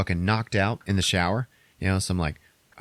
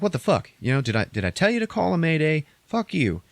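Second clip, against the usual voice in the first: high mock captain's angry rant; retold angry yelling; 7 semitones higher than usual. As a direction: bark it like an outraged captain, pitched well up. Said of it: "he's fucking yelling at Andrea".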